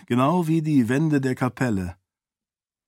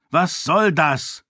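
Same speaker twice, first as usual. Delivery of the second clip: slow, raised, displeased demand; indignant challenge; 5 semitones higher, slower than usual. A narrator's reading. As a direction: demand an explanation, annoyed. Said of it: "wollte Raoden ungehalten wissen".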